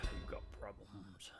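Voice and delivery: slow whispered mock warning; hushed ominous delivery. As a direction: breathe it out slowly like a threat.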